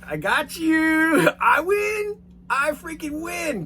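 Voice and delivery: very high, slow, loud gloating; gloating victory. Retold or imitated. To the angry flight attendant, high slow gloating. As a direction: crow about winning, stretching it out. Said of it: "And I was just like".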